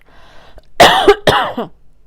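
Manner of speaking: loud, repeated coughing; real coughing fit; frog-in-throat cough. Real, not acted. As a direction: cough hard to clear the throat. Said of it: "j'ai un chat dans la gorge".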